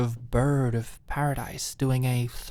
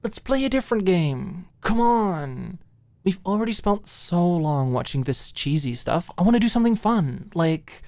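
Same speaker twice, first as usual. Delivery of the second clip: higher, louder eager pitch; excited; 4 semitones higher, 5 dB louder than usual. Acted. Alicia pleading with Maud for a fun game. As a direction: bounce with restless energy. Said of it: "emphasize her point".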